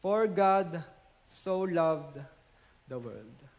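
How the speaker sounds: "For God so loved the world" drawn out very slowly, fading to near silence on "the world"; slow, solemn repeat. Shown as a demonstration. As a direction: linger on every word, let "the world" die away. Preacher repeats verse slowly. (dahan-dahan, halos pabulong sa dulo)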